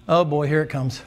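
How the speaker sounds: ordinary talk, nothing marked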